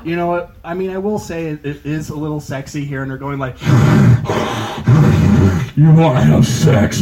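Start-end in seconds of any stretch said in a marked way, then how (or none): 3.6-5.7 loud rasping inhale like a breathing mask; loud mask gasp. Retold or imitated. Comic girlfriend in sleep mask, loud rasping gasp. 5.8-7.0 loud, breathy masked voice; Darth Vader mask voice. Retold or imitated. Girlfriend through sleep apnea mask.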